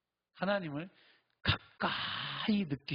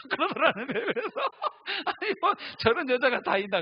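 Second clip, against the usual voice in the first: laughing; 12 semitones higher, 9 dB louder than usual. Real, not acted. Laughing at the story.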